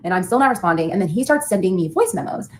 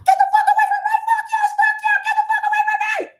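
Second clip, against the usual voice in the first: full terrified scream at her ex pulling up; screaming bloody murder. Shown as a demonstration. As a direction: scream like you're about to be kidnapped. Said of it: "I can demonstrate for you".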